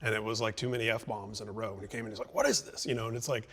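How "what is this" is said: imitating a dad, pitch jumping up high; mock disbelief as the dad bursts in; a lightly voiced baffled father; incredulous dad. Dad barging in on loud music.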